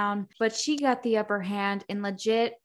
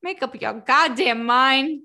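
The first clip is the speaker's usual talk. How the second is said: exasperated retort; 4 semitones higher, 6 dB louder than usual. Retold or imitated. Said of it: "I'm like".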